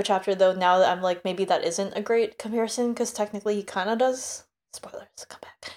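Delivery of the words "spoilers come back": hushed spoiler whisper; secretive whisper. Real, not acted. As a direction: whisper it so no one hears.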